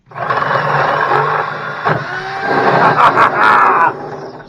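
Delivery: long, loud, high victory cackle; triumphant laugh. Real, not acted. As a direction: let out a big shouted laugh of triumph. Triumph after finally drilling through.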